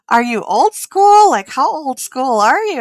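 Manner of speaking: retold amused disbelief; incredulous teasing.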